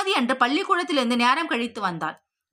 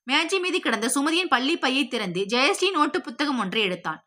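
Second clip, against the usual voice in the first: plain reading voice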